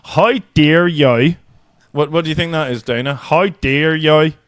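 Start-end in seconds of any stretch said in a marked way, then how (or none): 0.0-1.4 slow, drawn-out Belfast vowels; exaggerated Belfast demo; Belfast accent. Shown as a demonstration. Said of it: "I'm gonna go for Belfast". 3.1-4.3 slow, drawn-out Belfast vowels; exaggerated Belfast demo; Belfast accent. Shown as a demonstration. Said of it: "I'm gonna go for Belfast".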